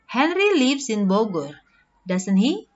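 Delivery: "doesn't he" lifting upward at the end, as a true question; rising intonation. Shown as a demonstration. Teacher showing a true question tag, rising intonation on "doesn't he". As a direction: ask it unsure, pitch climbing on "doesn't he". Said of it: "Rising intonation means you are asking a question".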